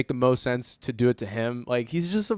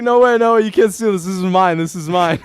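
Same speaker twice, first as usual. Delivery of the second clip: put-on much higher and louder, a childish "mine!"; whiny high protest; 5 semitones higher, 9 dB louder than usual. To Riley Reid, claiming the stolen dildo, high loud whiny protest. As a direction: plead like a kid guarding a toy, high and loud.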